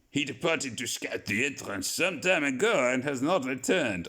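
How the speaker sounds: mock French accent, telling the others calmly; a deliberately clumsy French Passepartout; bad French accent. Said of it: "I'm amazing at accents".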